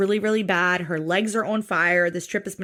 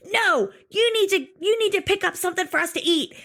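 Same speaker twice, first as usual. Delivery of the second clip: higher, quicker, insistent demand; bossy Gina; 9 semitones higher, faster than usual. Retold or imitated. Gina ordering her wife to pick up food, high and bossy. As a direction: push her to fetch the food.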